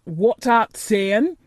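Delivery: slow, testy Yorkshire question; irritated old gardener asking; mildly testy old countryman; testily. A narrator's reading. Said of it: "he asked rather testily".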